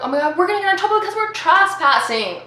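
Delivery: high, loud worried outburst; acted-out freak-out, pitched high; mock panic. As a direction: blurt it out in rising alarm. Acts out mounting panic.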